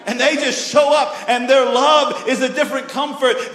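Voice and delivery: high, loud, slowed-down preaching; fired-up sermon climax; preaching at full force. Acted. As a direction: build it up like a revival preacher.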